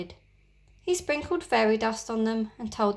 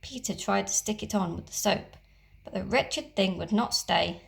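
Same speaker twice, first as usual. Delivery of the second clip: usual speaking voice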